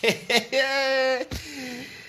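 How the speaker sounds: high giggling laugh; high giggle; laughter. Real, not acted.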